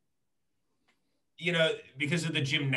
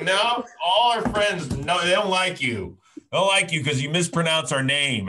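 hammed-up offended builder; mock scolding; 5 semitones higher, 7 dB louder than usual. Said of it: "He's like".

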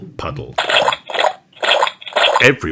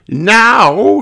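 much higher, louder teacher call; Madame Gazelle's voice; 13 semitones higher, 7 dB louder than usual. Acted. Madame Gazelle addressing the children, high and loud. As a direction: call the class to order. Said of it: "says Madame Gazelle".

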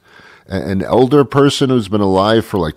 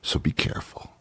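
hushed whispered warning; real whisper; 14 dB quieter than usual. Real, not acted. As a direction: whisper it like a secret. Warning after a long pause.

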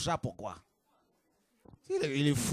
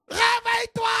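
very high, very loud cry of réveille-toi; shouted wake-up call; 10 dB louder, slower than usual. Shown as a demonstration. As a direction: yell it into a sleeper's ear. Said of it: "quand on crie là, c'est à l'oreille". (réveille-toi hurlé à l'oreille)

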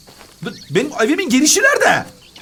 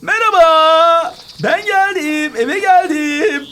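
drawn-out, higher arrival call; 5 semitones higher, 3 dB louder, much slower than usual. Pretend. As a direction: announce yourself to the whole house, stretching the words. Calls out on arriving home. (eve girerken uzatarak seslenme)

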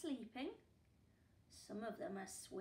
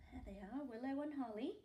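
ordinary delivery, nothing marked

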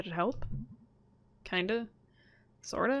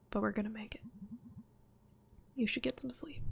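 soft whisper; 2 semitones higher, 7 dB quieter than usual. Acted. Soothing friend to sleep.

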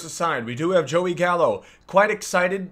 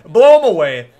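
shouting; 2 semitones higher, 14 dB louder than usual. Real, not acted. Cheering a pitch.

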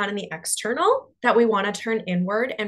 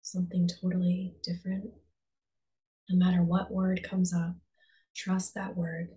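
calm, unhurried meditation voice with long pauses; guided meditation. Real, not acted. Coach guiding listeners to close their eyes and breathe, guided meditation. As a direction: ease them inward, leaving space after each phrase.

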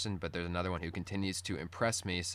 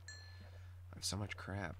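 muttering; 11 dB quieter, much slower than usual. Real, not acted. Muttering aside at inventory.

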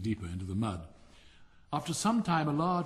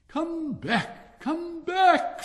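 much higher and louder shout; screaming; 10 dB louder, much slower than usual. Acted. Rocket screaming after the departing duck. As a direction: yell after her as she swims away. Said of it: "screamed the Rocket".

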